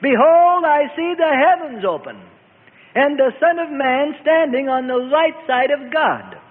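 high, ringing cry of triumph; triumphant shout. A narrator's reading. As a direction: call it out triumphantly, voice held high. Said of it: "triumphantly he shouted".

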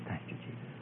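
tiny whispered echo; quiet repeated prayer. Retold or imitated. Young girl echoing the preacher's prayer, whispered. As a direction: repeat it almost without sound. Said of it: "very quietly".

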